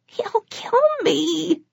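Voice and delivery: terrified, barely holding it together; whimper. A narrator's reading. Captured vampire whimpering to Bones, scared. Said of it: "It was a whimper".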